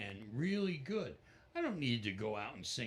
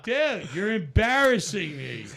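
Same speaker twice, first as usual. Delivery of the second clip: high, louder embarrassed protest; mock embarrassed; 9 semitones higher, 14 dB louder than usual. [name] telling his starstruck friend he is embarrassing him, loud. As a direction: tease the friend, pitched up. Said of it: "I'm like".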